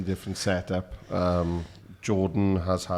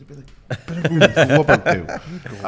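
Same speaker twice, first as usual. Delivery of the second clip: laughing; 7 semitones higher, 7 dB louder than usual. Real, not acted. Teasing new guest's entrance.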